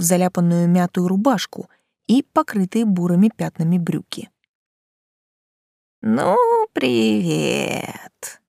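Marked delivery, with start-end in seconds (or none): 6.0-8.4 wheezy, hoarse zombie voice; raspy whisper. A narrator's reading. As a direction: rasp it out like a rotting corpse. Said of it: "просипел он".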